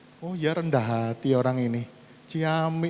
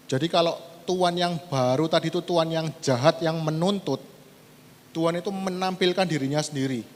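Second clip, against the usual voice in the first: ordinary talk, nothing marked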